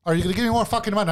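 high, fast, indignant challenge; angry retort. Retold or imitated. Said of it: "I was angry, bro".

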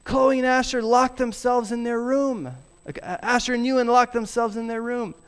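put-on high, fast, breathless kid voice; a kid out of breath calling for dad; breathless child. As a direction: play the panicked child bursting in. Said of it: "out of breath".

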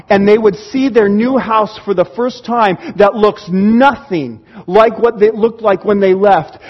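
usual speaking voice

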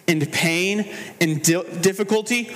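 normal talking voice

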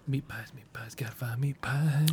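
unvoiced whisper to himself; hushed, eager whisper; whispered muttering. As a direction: whisper it like a secret craving. Player character muttering to himself.

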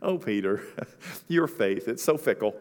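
Jesus fondly chiding Peter's faith; gentle fond rebuke. Acted. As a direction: tease him tenderly, not scolding. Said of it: "he's not chastising him".

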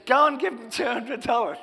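wife telling him straight to pay up; quoting his wife telling him to pay; wife's firm order. Retold or imitated. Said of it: "She says".

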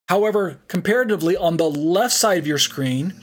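ordinary talk, nothing marked

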